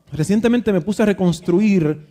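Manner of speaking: normal talking voice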